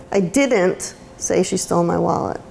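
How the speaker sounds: didn't punched with a falling tune; stress on DIDN'T. Shown as a demonstration. Teacher showing the class how emphasis shifts meaning, stress on didn't. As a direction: hit the word didn't. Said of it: "every word that you put emphasis on".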